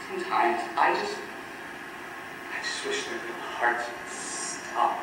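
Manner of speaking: low, hushed and halting; soft, low, near-voiceless admission; quiet dark confession. Acted. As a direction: confide a dark wish under your breath. Dark confession of wishing harm.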